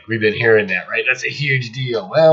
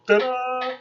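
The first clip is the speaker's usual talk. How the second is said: mock fanfare; 14 semitones higher than usual. Mock reveal of the mystery.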